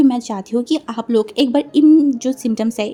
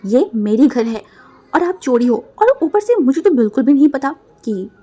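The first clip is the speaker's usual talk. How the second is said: loud raised angry threat; heated loud warning; 3 dB louder than usual. Acted. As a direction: threaten him hotly. Quarrel, threatening brother.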